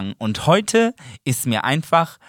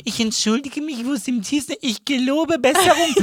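high, pitched-up mimicry of her apology; mocking imitation; 10 semitones higher than usual. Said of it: "Du hörst dich an wie Donald Trump".

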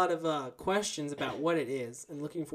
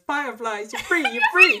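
much higher, louder mock-triumphant cry; hammed-up movie cry; 10 semitones higher, 11 dB louder than usual. Host quoting a film for listeners, hammed-up exultant cry. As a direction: shout it skyward, half laughing. Acting out a movie line, laughing.

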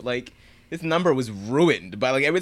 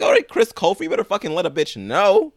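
much higher and louder sassy hype; hyped cheer; 9 semitones higher, 4 dB louder than usual. Retold or imitated. A fan hyping up Chris Colfer, loud and sassy. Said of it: "I was like".

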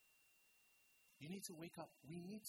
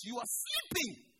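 slow, louder rebuke with a falling end; 7 dB louder, slower than usual. Shown as a demonstration. Preacher rebuking sleeping church, loud and slow. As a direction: wake them up with it.

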